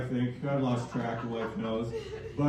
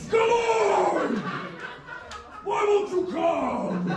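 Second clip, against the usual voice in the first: Adam Sandler impression; 13 semitones higher, much slower than usual. Comic mimicking Adam Sandler to his wife in bed, high whiny yell. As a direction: whine it out like a goofy Sandler character. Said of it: "I'm gonna do Adam Sandler".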